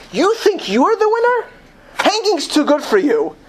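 high-pitched, incredulous officer's taunt; acted angry sneer at a prisoner; scornful retort.

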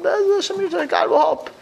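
put-on high, airy pious voice of a carefree kollel boy; naive son-in-law. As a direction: float it up high, unworried. Son-in-law answer in joke.

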